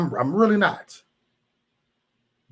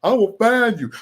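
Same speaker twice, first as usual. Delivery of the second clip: mock threat; 7 semitones higher, 4 dB louder than usual. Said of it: "Just find them".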